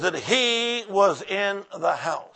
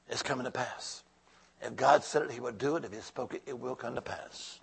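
much softer, letting the words sink in; hushed repeat; 7 semitones lower, 11 dB quieter than usual. Real, not acted. Pastor quietly assuring the congregation the prophecy will come true, hushed. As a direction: drop way down to a near hush. Repeating promise softly to church.

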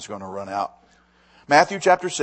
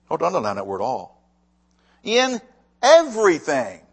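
emphatic scripture reading; 4 semitones higher, 6 dB louder than usual. Acted. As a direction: read it out to the congregation like a promise. Preacher reads verse with emphasis.

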